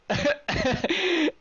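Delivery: loud high cackle of delight; laughing. Real, not acted. Player delighted by the punch, laughing high.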